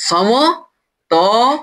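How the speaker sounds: সমতলতল stretched very slowly with a rising end; drawn out. Shown as a demonstration. Teacher prompting students to say the term, very slow. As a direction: draw the word out as a prompt. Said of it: "কি বলা হয়?".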